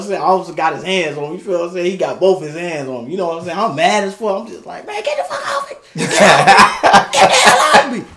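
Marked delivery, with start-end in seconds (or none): 4.9-8.0 high, strained mock yell through laughs; angry protest, played for laughs; retold yelling. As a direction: act out yelling at someone to get off. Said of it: "I'm just in that bitch yelling and screaming".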